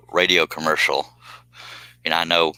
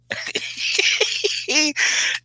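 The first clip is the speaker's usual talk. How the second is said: high giggle. Real, not acted. Laughing at own joke.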